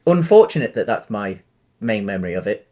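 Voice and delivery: ordinary talk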